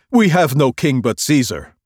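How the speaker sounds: loud firm retort; priests declaring. Acted. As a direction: reject him before the governor. Chief priests answer.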